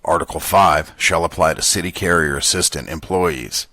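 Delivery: gruff, scratchy reading voice; Dan Carlin's gruff quote voice. Acted. Podcaster reading contract language aloud so it stands out from commentary, gruff. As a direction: read the contract in a gruff, throaty voice. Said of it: "use his gruff voice that he uses for his quotes".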